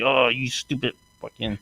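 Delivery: soft, under the breath; muttered insult. Retold or imitated. Muttering at the guard he can't understand. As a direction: mutter the insult half to yourself. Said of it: "So I was just like".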